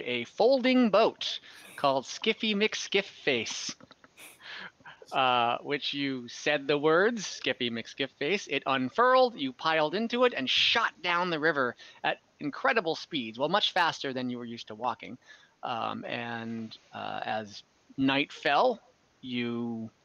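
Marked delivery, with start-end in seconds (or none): none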